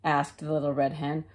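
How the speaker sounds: plain reading voice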